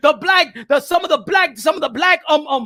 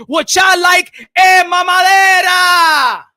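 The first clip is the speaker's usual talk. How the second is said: loud, higher taunt with the last word drawn out; mocking shout; 3 semitones higher, 5 dB louder, slower than usual. Real, not acted. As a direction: shout it as a jeer, stretching the end. Mocking rant at his critics.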